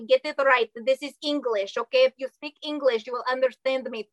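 stern mock-Russian instructor; bossy Russian-accented sales pitch. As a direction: lay down orders in a put-on Russian accent. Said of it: "you have to do a Russian accent".

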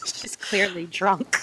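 whispered aside. Real, not acted. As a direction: hiss the aside under breath. Aside said under breath.

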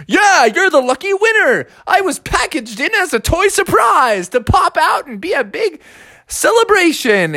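high, excited cartoon captain; Captain Crunch. Captain telling the boy he's the winner, jubilant. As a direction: proclaim it like a game-show prize. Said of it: "He's like".

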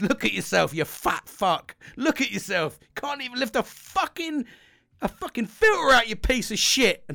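high, loud furious self-abuse into the mirror; yelling at himself. Retold or imitated. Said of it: "I stood there and I said".

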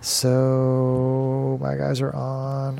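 very slow, stretched words; slow drawl. Acted. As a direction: draw it out lazily.

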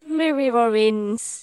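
loud, stretched-out, smeared words; slow, loud garbled name; reversed-recording imitation. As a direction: drag the name out loudly as if played backwards. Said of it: "you sounded like this".

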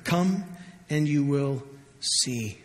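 soft, slow and tender invitation; warm invitation. Acted. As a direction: say it like a gentle open door, slowly. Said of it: "some of the warmest words you will ever hear".

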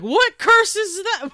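high, loud, drawn-out mock disbelief; retold bafflement. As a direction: exaggerate your baffled outcry. Retells own bafflement at the text.

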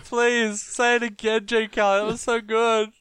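high-pitched sarcastic gushing; over-the-top, high mock delight; mock raving. Mock raving, then "Nah, it's terrible.

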